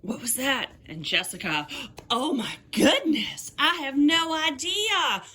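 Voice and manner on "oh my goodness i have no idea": high-pitched, hammed-up Southern belle; terrible Southern accent. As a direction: put on a bad, dramatic Southern accent. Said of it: "Jessica has a terrible Southern accent".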